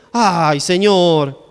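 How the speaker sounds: long drawn-out, reluctant sigh of words; mock pleading. Moses begging God to send someone else, drawn-out mock plea. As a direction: stretch it out like a weary plea.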